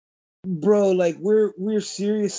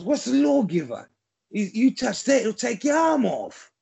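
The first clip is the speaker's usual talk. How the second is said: a lightly voiced Cockney pawnbroker, higher; Bob Hoskins impression; 5 semitones higher than usual. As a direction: warn him off like a London shopkeeper. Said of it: "He goes".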